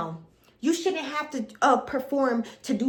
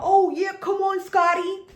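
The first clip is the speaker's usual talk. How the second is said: higher, louder mock hype man call; 6 semitones higher, 5 dB louder, slower than usual. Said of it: "[name] over here talking about".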